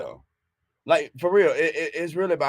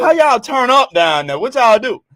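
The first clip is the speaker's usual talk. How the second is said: loud, eager, higher voice; excited; 7 semitones higher, 8 dB louder than usual. Real, not acted. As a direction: hype about a weekend trip. Excited about Kentucky partying.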